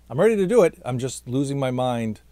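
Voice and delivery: ordinary talk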